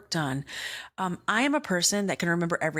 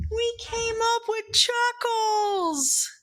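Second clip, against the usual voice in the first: much higher, slow proud reveal; triumphant reveal; slower than usual. Real, not acted. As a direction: announce it like a victory.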